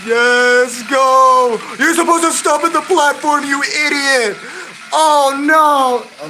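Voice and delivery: loud, high shouting at the driver; frantic, high-pitched scolding; exasperated yelling. Pretend. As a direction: yell in mock outrage at a blunder. Train misses the platform.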